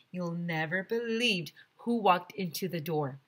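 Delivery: ordinary delivery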